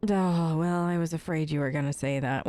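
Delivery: ordinary talk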